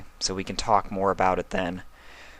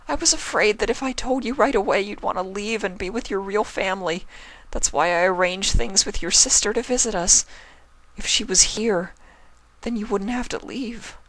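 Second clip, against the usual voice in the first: tearful. Acted. Twilight confessing through tears.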